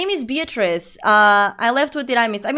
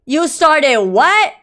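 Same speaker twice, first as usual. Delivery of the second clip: louder and slow, a mock stunned question; shocked mom; 4 semitones higher, 7 dB louder, much slower than usual. Answering the viewer as a horrified mom, louder and slow. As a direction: play the horrified mother.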